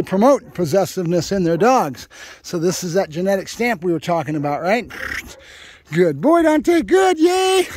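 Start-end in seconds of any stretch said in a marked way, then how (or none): 5.9-7.7 high excited dog praise. Real, not acted. Trainer praising the pup for the fetch, high and loud. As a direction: cheer the dog on, bright and loud.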